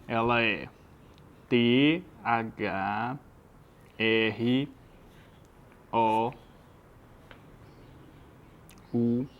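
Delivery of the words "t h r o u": letter by letter with long pauses; spelling out. Shown as a demonstration. Teacher writes the word out for the student, spelling with long gaps. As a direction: spell it slowly as if writing it down. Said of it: "deixa eu escrever pra você".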